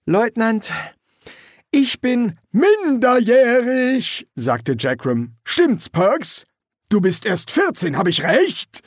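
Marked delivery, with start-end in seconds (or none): 0.1-4.3 high, loud, slowly stretched announcement; declaring sergeant. Acted. Feldwebel spricht Geständnis für Perks. 5.4-8.8 high, pressing sergeant's questions; prompting sergeant. Acted. Feldwebel setzt Rekrut unter Druck.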